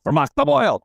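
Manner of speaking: a hint of a cross mother telling him to hush; mom scolding. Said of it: "She'd go".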